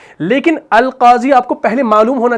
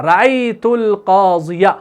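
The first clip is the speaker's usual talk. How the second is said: slow demo reading; much slower than usual. Shown as a demonstration. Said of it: "teacher reads Arabic example".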